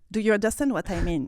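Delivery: ordinary talk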